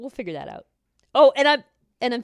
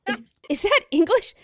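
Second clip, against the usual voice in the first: confused tourist; 11 semitones higher, 6 dB louder than usual. Retold or imitated. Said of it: "talks like this".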